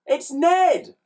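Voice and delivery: put-on high, loud boy's voice; announcing boy. A narrator's reading. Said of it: "announced Ned".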